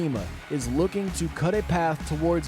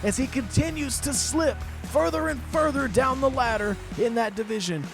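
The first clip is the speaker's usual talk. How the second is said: raised, high-pitched wrestling commentary; excited match recap; 8 semitones higher than usual. Real, not acted. Commentator telling folks of a shock defeat, excited. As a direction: keep the thrill of the finish while summing up.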